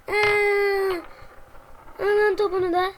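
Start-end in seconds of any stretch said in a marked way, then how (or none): 0.1-1.0 loud startled cry of fright; scream. Acted. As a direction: cry out as something jumps at you. Sudden fright.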